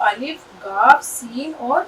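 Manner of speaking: letter by letter, slowly; slow, careful spelling. Shown as a demonstration. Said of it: "spelling out letters".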